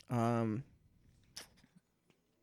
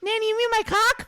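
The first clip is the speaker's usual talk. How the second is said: put-on little boy voice; 13 semitones higher, 7 dB louder than usual. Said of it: "little Joey".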